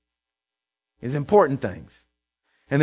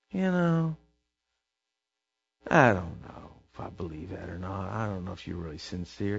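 much lower and softer, weary and unimpressed; grumbling skeptical deity voice; mock dismissive God; 12 semitones lower, 13 dB quieter than usual. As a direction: sigh it out like a God who's had enough. Voicing God dismissing doubter.

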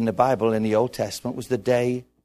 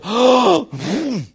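big loud intake of breath; hammed-up gasp of realisation; dramatic gasp; 11 semitones higher, 8 dB louder than usual. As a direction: gasp loudly, then let it out. Acting Elijah's reaction to the news.

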